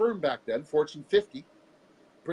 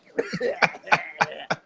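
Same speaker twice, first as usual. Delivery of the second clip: laughter; 5 dB louder than usual. Real, not acted. Laughing at own story.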